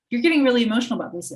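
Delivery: rapid-fire accusing remark; acted accusation. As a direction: voice a critic calling someone out, fast and forceful. Voicing someone calling out emotion.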